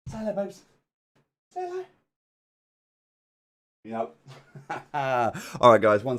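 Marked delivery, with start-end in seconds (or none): 0.1-1.8 much higher, softer coaxing puppy voice. Real, not acted. Coaxing dog to greet.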